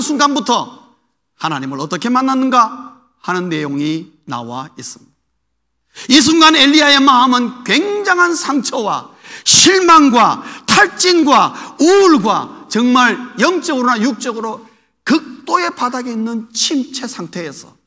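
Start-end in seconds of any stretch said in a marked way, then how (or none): 6.1-17.7 slow, loud emphatic preaching; forceful preaching. Acted. Preacher describing Elijah's despair to the congregation, slow and forceful. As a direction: hammer each word home slowly.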